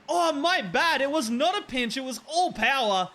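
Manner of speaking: high, emphatic, punchy correction; thrilled sportscaster correcting the call; hyped goal commentary. Real, not acted. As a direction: keep the excitement of the winner. Hyped correction after winning goal.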